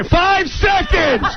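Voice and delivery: high, slow, drawn-out shout; countdown call. Retold or imitated. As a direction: call the countdown across a studio. Stage manager's countdown before live air.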